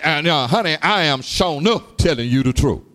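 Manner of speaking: normal talking voice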